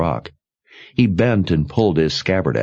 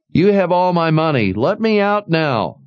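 high pleading voice; 11 semitones higher than usual. A narrator's reading. Trapped man begging from under rock.

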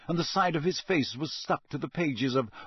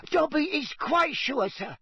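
high, squeaky, earnest house-elf; Dobby; 13 semitones higher than usual. A narrator's reading. Elf assuring Harry he is sure, squeaky and earnest. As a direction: squeak it in complete sincerity. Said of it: "said the elf earnestly".

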